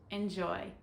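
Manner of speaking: ordinary delivery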